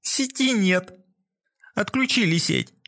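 high tearful wail; sobbing cry. A narrator's reading. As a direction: whimper it in despair. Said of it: "она снова всхлипнула".

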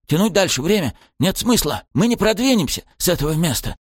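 gasping for air mid-fight; out of breath. Acted. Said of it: "С такой же безумной одышкой".

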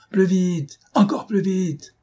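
high, insistent cry to the driver; urgent yell. A narrator's reading. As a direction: urge the driver on, pitch way up. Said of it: "hurla Lupin".

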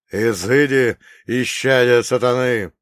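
loud exorcising cry; громкий возглас. Acted. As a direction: proclaim it like a priest banishing evil. Монах изгоняет нечисть молитвой. (громогласно, как при изгнании беса)

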